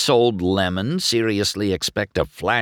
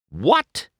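high, rising disbelief; repulsed demand; 9 semitones higher, 3 dB louder than usual. A narrator's reading. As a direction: demand it in mild disgust. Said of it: "McQueen demanded, completely repulsed".

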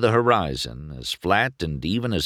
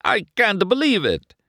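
high-pitched delighted disbelief; laughing delight; 14 semitones higher, 3 dB louder than usual. A narrator's reading.

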